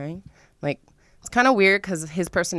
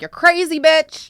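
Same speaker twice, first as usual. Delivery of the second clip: high, loud mock outburst; mock yell; 11 semitones higher, 5 dB louder than usual. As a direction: throw it out in high disbelief. Retold thought, mock outburst.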